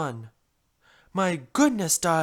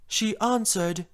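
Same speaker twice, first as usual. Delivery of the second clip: usual speaking voice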